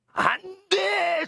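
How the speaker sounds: high, slow, drawn-out cry of refusal; emphatic prohibition. Acted. Preacher proclaiming each banned sin to the congregation, emphatic prohibition. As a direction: stretch the ban out at a high pitch.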